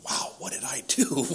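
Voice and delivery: a stunned whisper with no voice; whispered thought. Retold or imitated. Arrested man whispering his shock to himself. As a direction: breathe it out as a shocked inner thought. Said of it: "And I'm like".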